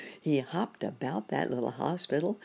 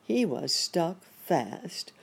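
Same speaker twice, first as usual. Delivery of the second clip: plain reading voice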